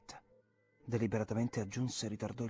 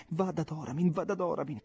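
higher, louder urgent repeating; feverish insistence; 8 semitones higher, 7 dB louder than usual. A narrator's reading. Girl begging Jim to go to Oramin, feverish. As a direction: push the words out feverishly. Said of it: "con un'insistenza febbrile".

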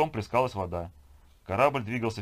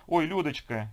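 high, louder glad greeting; cheerful exclamation; 8 semitones higher, 5 dB louder, slower than usual. Acted. Woman running into her friend on deck, cheerful. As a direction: exclaim it merrily, pitch jumping up. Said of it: "весело воскликнула спутница Сергея".

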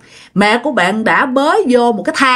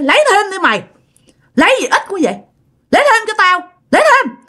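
possessed demand; 9 semitones higher, faster than usual. Retold or imitated. Possessed aunt demands more food.

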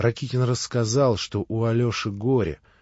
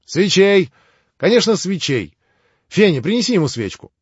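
high, loud, fast call to the maid; excited calling out; 8 semitones higher, 7 dB louder than usual. Acted. Grushenka, fussing over guests, calling to Fenya excitedly. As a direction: call out eagerly for the candle.

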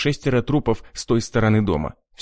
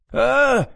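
pained scream; 13 semitones higher than usual. A narrator's reading. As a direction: scream as the knife turns. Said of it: "с новой силой заорал сатанист". (крик боли)

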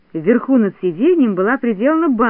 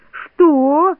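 high, loud, incredulous; outraged cry; 7 semitones higher, 5 dB louder than usual. A narrator's reading. Everyone hears of Neznayka's threat, crying out in outrage. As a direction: burst out in shocked indignation. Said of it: "закричали тут все".

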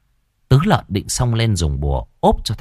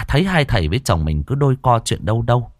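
plain reading voice

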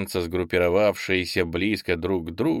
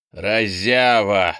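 slow, loud, drawn-out rebuke; scolding; 5 dB louder, much slower than usual. A narrator's reading. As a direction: scold the careless fighter, stretching the word. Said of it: "отругал Гремшика попаданец". (громко и протяжно ругает)

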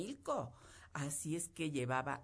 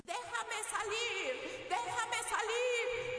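enraged devil. Acted. Trapped devil kicking the bottle, begging to get out, shrill and furious. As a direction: screech to be let out of the bottle. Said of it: "estaba tan enojado".

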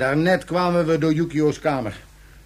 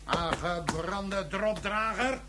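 slow, much softer insult; slow quiet jibe; muttered insult; 3 semitones higher, 9 dB quieter, much slower than usual. Acted. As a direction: grumble it under your breath. Prisoner insults colonel under breath.

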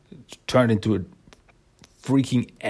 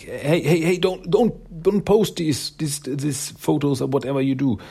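high-pitched, stammering objection; acted flustered pleading; urgent plea; 5 semitones higher than usual. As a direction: stop them with a pitched-up string of protests. Heroine stops people filming.

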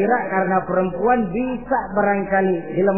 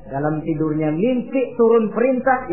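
usual speaking voice

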